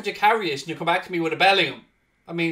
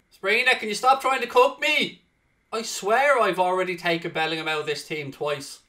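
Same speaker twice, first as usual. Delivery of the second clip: usual speaking voice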